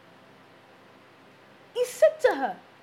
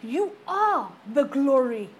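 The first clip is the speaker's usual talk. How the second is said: slow, loud, each word weighted; slow ringing proclamation; slow emphatic declaration; 5 dB louder, slower than usual. Acted. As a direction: proclaim it to the congregation. Climactic declaration.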